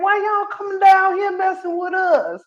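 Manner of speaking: high-pitched mock indignation; exasperated local. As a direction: whine it like a fed-up local. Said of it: "It's like".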